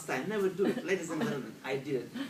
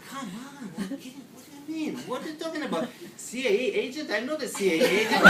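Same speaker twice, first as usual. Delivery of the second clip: high-pitched, rapid, baffled protest; exasperated disbelief; 7 semitones higher, 4 dB louder, much faster than usual. Retold or imitated. Panicked driver answering a calm Canadian, exasperated. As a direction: fire back incredulous questions, getting louder.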